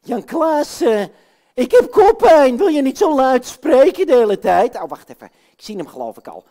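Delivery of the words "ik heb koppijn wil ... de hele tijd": high, loud whiny complaint. Acted. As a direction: whine it out loud about the headache. Katrijn complains of headache loudly.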